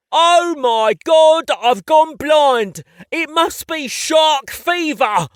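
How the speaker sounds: loud, slow, hammed-up English accent panic; hammy mock panic. Pretending to go blind while playing with a child, mock panic. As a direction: wail like a blinded stage pirate.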